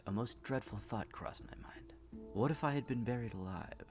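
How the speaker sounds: unvoiced fearful whisper; whispered dread. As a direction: breathe out the horror of it. Dread of being buried alive.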